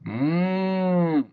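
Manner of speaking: long high moo; cow moo. A narrator's reading. Cow calling to the busy spider, mooing. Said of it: "said the cow".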